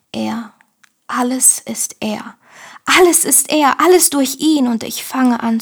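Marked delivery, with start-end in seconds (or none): 0.1-2.4 whispered. Acted. Whispered devotional poem line. 2.8-4.7 proclaimed aloud. Acted. Repeated line, now proclaimed aloud.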